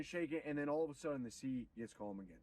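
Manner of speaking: ordinary delivery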